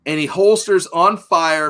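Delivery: ordinary delivery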